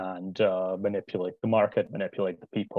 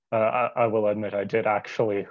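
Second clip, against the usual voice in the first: ordinary talk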